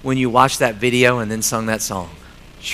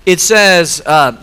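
ordinary talk, nothing marked

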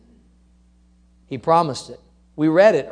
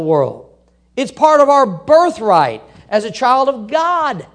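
loud, high, building sermon cry; full-throated preacher proclaiming; preaching at full force; 6 semitones higher, 6 dB louder than usual. Real, not acted. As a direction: preach it out with rising fire. Preaching crescendo.

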